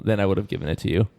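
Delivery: ordinary delivery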